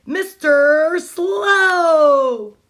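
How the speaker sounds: each word stretched out long and loud; very slow. Shown as a demonstration. Teacher reading to kids, drawls the title very slowly. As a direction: drag out the name like Mister Slow himself. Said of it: "I am the opposite of Mister Slow".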